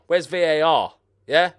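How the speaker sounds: indignant shout for the video referee; loud demand. Real, not acted. Gamer at the screen shouts for VAR, indignant. Same question repeated louder.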